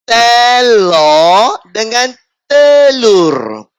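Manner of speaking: slow, then shout "telur"; "telur" stretched slow then shouted; loud drawn-out telur. Acted. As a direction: burst out with "telur" loudly. Bursting out the word telur.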